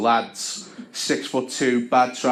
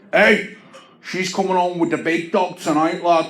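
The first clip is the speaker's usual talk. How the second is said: higher, louder macho bark; higher macho boast; aggressive lad; 5 semitones higher, 3 dB louder than usual. Retold or imitated. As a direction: puff up like a big-dog bouncer type. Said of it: "He's like".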